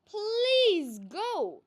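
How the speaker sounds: high, loud begging; pleading. A narrator's reading. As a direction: beg him to leave. Zwazo begs Malice to go.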